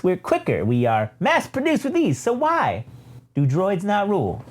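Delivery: high, theatrical droid voice; high-pitched villain droid orating; evil droid. Acted. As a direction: make a swooping, grandiose speech as a droid. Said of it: "evil ass triple zero pops in and says".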